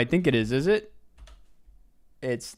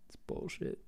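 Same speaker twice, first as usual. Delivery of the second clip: very soft, whispered complaint; whispered grumble; 18 dB quieter than usual. Real, not acted. Streamer whispering a complaint about the game. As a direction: hiss it under your breath. Grumbling after a failed guess.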